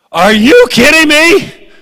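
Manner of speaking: high, loud, drawn-out cry of disbelief; outraged disbelief. Retold or imitated. Moses told he won't enter the promised land, outraged. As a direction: throw it up to heaven in outrage.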